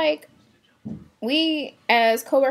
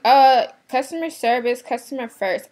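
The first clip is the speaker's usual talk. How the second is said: imitating a snooty shopper, louder on customer service; mocking customer; 3 semitones higher, 7 dB louder than usual. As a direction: parrot the rude lady's slogan, loud then dropping. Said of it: "She's like".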